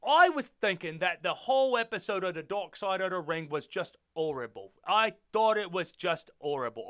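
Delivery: put-on, halting British accent. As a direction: keep up an amateur British accent. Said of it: "that is a British accent".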